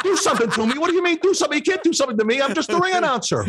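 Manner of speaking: very high, laughing disbelief; mock terror. To the chief, squealing high in mock terror. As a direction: squeal your panic way up high.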